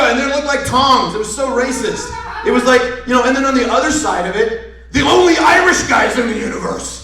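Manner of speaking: normal talking voice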